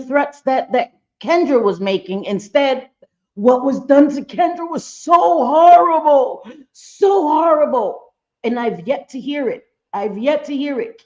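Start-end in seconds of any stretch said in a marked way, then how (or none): 3.3-8.1 slow, high, loud stress on "horrible"; drawn out emphasis. Real, not acted. Host stressing to viewers how bad it was, slow emphatic stress on "horrible". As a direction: lean hard into "so horrible", stretching it out.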